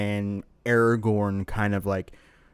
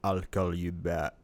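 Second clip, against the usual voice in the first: slow, low, soft Austrian menace; Austrian accent; 4 semitones lower, 8 dB quieter, slower than usual. Pretend. Joking about a threatening callback, low Austrian accent. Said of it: "with an Austrian accent".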